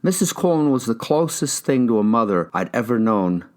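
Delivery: usual speaking voice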